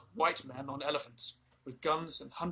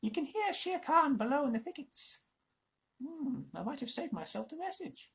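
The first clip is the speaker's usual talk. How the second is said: sly jackal; 12 semitones higher than usual. Acted. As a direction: leave with a parting sneer. Tabaki voice held.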